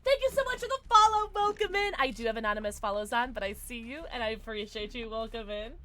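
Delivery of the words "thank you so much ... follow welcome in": bright greeting. Real, not acted. Greeting a new follower.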